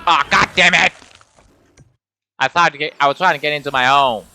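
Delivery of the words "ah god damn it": loud angry curse; frustrated outburst. Real, not acted. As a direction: swear in frustration. Killed by raptors.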